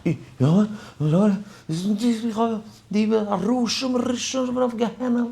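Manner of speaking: very high put-on voice of the other man; quoting the man. Retelling the rabbi's confrontation, high imitating voice. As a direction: squeeze it up high like the accuser. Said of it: "Und er sagt".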